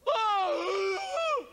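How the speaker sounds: sudden high shout of fright; involuntary fright cry. Retold or imitated. Body reacting to a gunshot, startled yell. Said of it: "you hear your body going".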